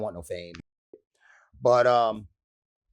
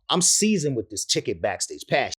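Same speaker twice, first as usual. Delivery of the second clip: ordinary talk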